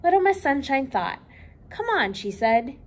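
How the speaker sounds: lively read-aloud for children, voicing each character; storybook reading. A narrator's reading. Librarian reading Little Miss Sunshine to children, lively storytelling.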